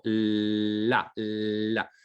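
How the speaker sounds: La with a long held l; lengthened l. Shown as a demonstration. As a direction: draw out the l in La. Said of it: "temporalizarlas con un mayor, eh, tiempo".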